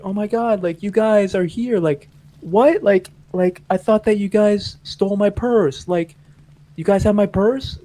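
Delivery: high-pitched impression of an upset friend; imitated tearful disbelief. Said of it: "Like, she's still crying".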